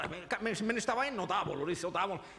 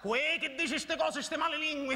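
declaiming against slanderers; indignant outburst; 7 semitones higher, 4 dB louder than usual. Acted. Indignant at being called mad.

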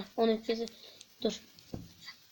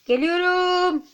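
drawn-out call; 6 semitones higher, 6 dB louder, much slower than usual. Real, not acted. Calls out while going.

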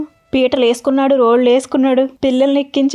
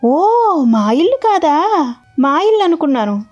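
put-on innocent child; 4 semitones higher, slower than usual. Little Krishna feigning innocence.

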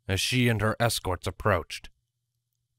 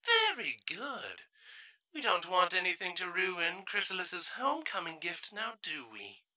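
higher, much softer sinister purr; sinister queen; 8 semitones higher, 10 dB quieter than usual. Acted. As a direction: purr it with quiet menace. Ancient queen voice.

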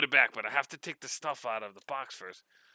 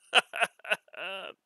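higher chuckling laugh; 7 semitones higher than usual. Real, not acted. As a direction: chuckle at the towels. Laughing about puppy towels.

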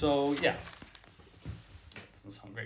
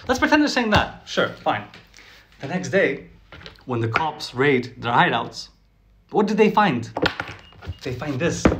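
normal talking voice

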